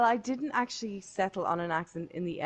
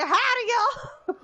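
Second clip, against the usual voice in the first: put-on far higher, slow, laughing cowboy Southern accent; hammed-up Southern howdy; 5 dB louder, slower than usual. Mimicking Fraser's Southern accent to a friend, laughing hammed-up drawl. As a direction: overdo a cartoon cowboy greeting. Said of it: "it was all like".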